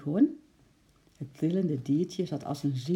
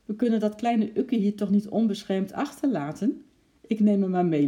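slightly higher, louder character line; lightly voiced ape; 5 semitones higher, 5 dB louder than usual. A narrator's reading. Ape telling girl he adopts squirrel, lightly voiced. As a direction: speak up as the determined ape. Narrator voices the ape's thoughts.

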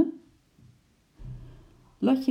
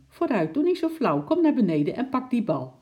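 put-on high, loud girl calling up the tree; 8 semitones higher, 4 dB louder than usual. A narrator's reading. Lotje calling up to stubborn Sjimp, loud and high. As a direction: shout up, impatient.

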